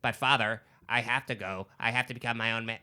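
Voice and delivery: lightly imitated dubbed movie voice; dubbed American actor. Host mimicking the dubbed voice in Hercules in New York, lightly imitated. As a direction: do a mild mock film-dub voice. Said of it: "that is what he sounds like".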